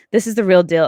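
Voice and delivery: ordinary talk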